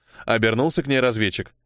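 ordinary delivery

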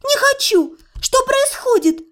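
high-pitched, frantic protest; upset refusal. Acted. Patient resists the necromancer's calming, high agitated protest.

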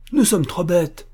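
a high, loud outburst; young officer bursting out in frustration; exclaiming. A narrator's reading. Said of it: "s'écria-t-il".

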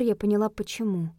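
ordinary delivery

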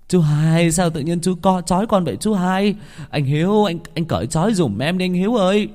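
high, urgent pleading; pleading boy. Acted. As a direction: beg the uncle to untie him. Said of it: "giọng khẩn thiết". (giọng van nài khẩn thiết)

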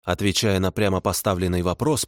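usual speaking voice

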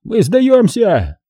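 high, drawn-out call; surrendering cry. A narrator's reading. Poles at the wall surrendering, crying out. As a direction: call across to give up. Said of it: "закричали от стены".

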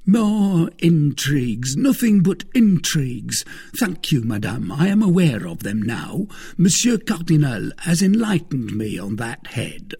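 a touch of self-important protest; Bonacieux, indignant husband. Acted. Husband rebuffing his wife's intrigues, indignant.

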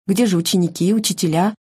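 usual speaking voice

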